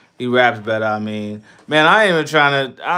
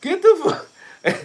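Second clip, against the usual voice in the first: outraged yelp. Real, not acted. Streamer ranting about Godzilla, yelping in disbelief. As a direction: shriek it in disbelief.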